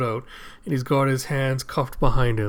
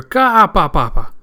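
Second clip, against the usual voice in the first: high, loud burst, then dropping "pa"s; cartoonish staccato laugh; odd cackle; 5 semitones higher, 8 dB louder, slower than usual. Imitating the same strange laugh.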